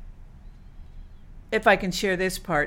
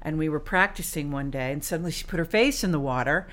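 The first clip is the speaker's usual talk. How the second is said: normal talking voice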